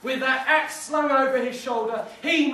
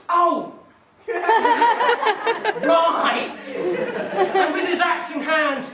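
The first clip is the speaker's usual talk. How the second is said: much higher, laughing, slow restart; laughing at a slip; 6 semitones higher, 5 dB louder, much slower than usual. Real, not acted. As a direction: giggle then regain the line. Narrator laughs at own slip.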